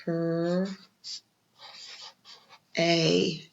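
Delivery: "Cur" and "a" as separate slow sounds; sounding out. Shown as a demonstration. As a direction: sound out each letter slowly. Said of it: "let's spell that part".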